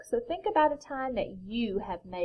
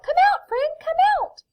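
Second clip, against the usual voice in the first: very high, loud squeaky shout; mouse shouting; 10 dB louder, faster than usual. A narrator's reading. Mouse to the hidden friend, squeaky shout. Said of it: "Mouse starts to shout".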